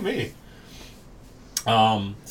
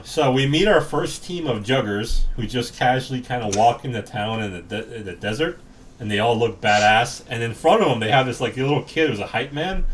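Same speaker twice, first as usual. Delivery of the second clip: normal talking voice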